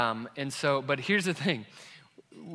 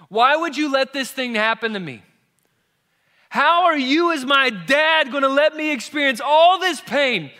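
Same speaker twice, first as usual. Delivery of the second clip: put-on much higher and louder, a wounded child's accusing wail; outraged little boy; 11 semitones higher, 12 dB louder than usual. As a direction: rail at dad like a betrayed kid. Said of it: "He said".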